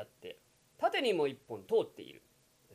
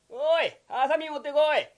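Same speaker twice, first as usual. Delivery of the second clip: ordinary delivery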